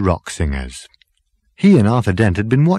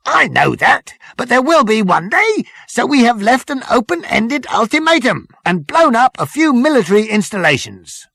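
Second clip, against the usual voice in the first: high, loud parade-ground reporting; zealous military subordinate; 3 dB louder than usual. Acted. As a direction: report to the captain like an eager officer. Number Two explains war.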